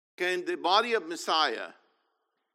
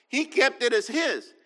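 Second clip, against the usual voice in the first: normal talking voice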